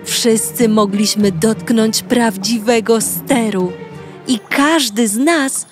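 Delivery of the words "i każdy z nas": ordinary delivery, nothing marked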